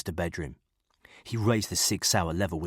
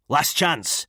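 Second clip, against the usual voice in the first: shouted warning; 9 semitones higher, 8 dB louder than usual. A narrator's reading. Final warning before shooting.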